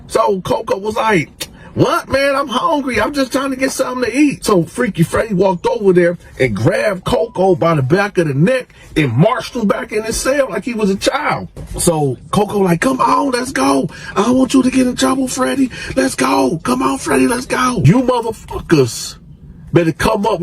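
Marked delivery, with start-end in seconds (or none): 1.7-4.4 high, loud, whining protest; whiny reply. Retold or imitated. Hungry cellmate whining back at Freddy. 12.8-17.8 high, loud coaxing plea; high pleading. Retold or imitated. Cellmate pleading with Freddy to leave.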